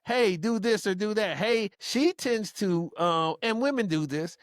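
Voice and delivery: ordinary talk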